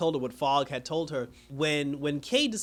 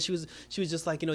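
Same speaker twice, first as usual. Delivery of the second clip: usual speaking voice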